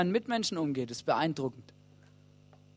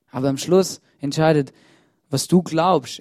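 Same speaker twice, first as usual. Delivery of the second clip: loud; 9 dB louder than usual. Acted. Sermon key line, first take.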